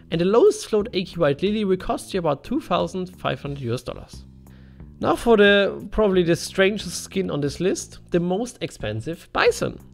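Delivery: normal talking voice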